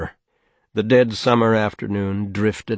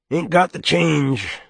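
whiskey voice; 8 semitones higher than usual. A narrator's reading. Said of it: "the whiskey voice said".

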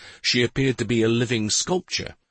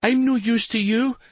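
high, pleading whine; whining; 13 semitones higher, 3 dB louder than usual. A narrator's reading. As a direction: whine like a frightened girl. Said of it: "Carandin whined".

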